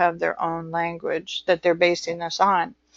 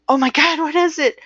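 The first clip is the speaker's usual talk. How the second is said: high, flustered mock alarm; imitating a stressed-out outburst; mock panic; 8 semitones higher, faster than usual. To the class, mimicking everyday stress with high panic. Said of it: "our stress about".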